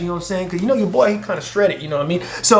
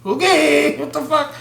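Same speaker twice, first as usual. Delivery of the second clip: shocked higher; 9 semitones higher than usual. Retold or imitated. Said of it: "she was like".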